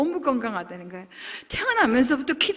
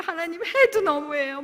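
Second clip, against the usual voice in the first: tearful protest; 6 semitones higher, 3 dB louder than usual. Retold or imitated. Crying complaint retold.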